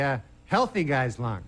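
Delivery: ordinary talk, nothing marked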